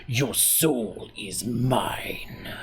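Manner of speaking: very slow, breathy whisper dragging out every word; whispered villain voice. Shown as a demonstration. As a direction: hiss the threat like a soul-stealing sorcerer. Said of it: "but you gotta put the".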